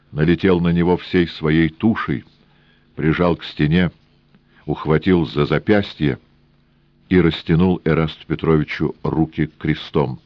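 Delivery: plain reading voice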